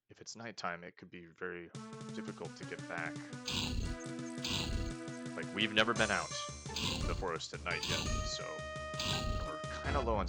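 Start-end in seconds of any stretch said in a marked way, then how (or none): none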